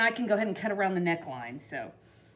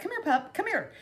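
higher, coaxing call; coaxing the dog; 9 semitones higher than usual. Retold or imitated. Retelling calling a dog out of traffic, coaxing high. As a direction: sweetly coax a scared pup closer.

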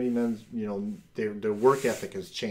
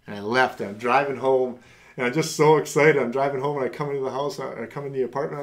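normal talking voice

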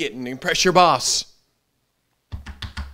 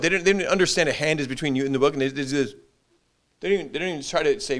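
usual speaking voice

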